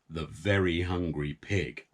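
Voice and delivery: ordinary delivery, nothing marked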